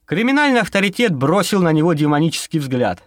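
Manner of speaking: ordinary delivery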